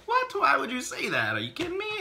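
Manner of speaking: high-pitched, aghast reproach; mock disbelief. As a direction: squeak it out, appalled at your cousin. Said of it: "I'm sitting there like".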